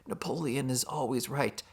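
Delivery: soft, thin dying-words voice; barely able to utter. Acted. Boxer's supposed last words from his hospital bed, barely uttered. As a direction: feebly force out the last words, hammed-up. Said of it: "he is barely able to utter".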